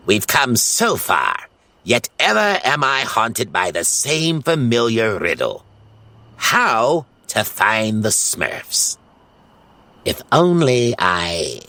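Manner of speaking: slow, theatrical villain voice; scheming wizard lamenting to his cat; brooding villain. Acted. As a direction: muse darkly like a cartoon sorcerer.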